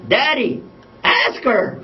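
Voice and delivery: put-on high-pitched urging child; little daughter. Daughter comes over to push dad to propose, high childlike urging. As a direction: imitate a girl prodding her daddy. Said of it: "She comes over to me".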